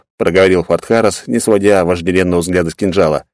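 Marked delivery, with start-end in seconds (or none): none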